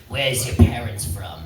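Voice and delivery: lightly voiced dad, slow and low; dad impression. Comic imitates his dad questioning a crowd member, slow and low. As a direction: ask it like a stern immigrant father, slowly. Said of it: "I'll do an impression of my dad".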